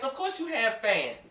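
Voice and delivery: ordinary talk, nothing marked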